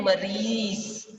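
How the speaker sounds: मरीज slowly, ending on a buzzing z; stressed z sound. Shown as a demonstration. Teacher stressing the z in मरीज for the class, slowly. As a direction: lean on the final z of मरीज. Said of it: "ज के नीचे जोर लेते हुए".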